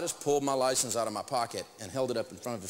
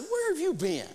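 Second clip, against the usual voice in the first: mock disbelief; 12 semitones higher than usual. Retorting to wife, incredulous.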